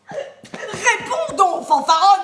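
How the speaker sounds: plus fort et plus haut, Réponds donc traîné, fanfaronne crié; sommation furieuse. Acted. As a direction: crier fanfaronne pour la forcer à répondre. Nourrice somme Antigone de répondre. (la nourrice crie sur Antigone, furieuse)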